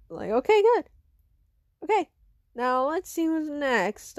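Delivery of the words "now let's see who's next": plain reading voice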